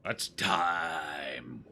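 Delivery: "time" stretched way out in mimicry; exaggerated, elongated "time"; mocking drawn-out imitation. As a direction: keep mocking, drag TIME out long. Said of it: "wasting your own time saying it like that".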